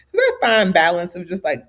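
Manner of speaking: usual speaking voice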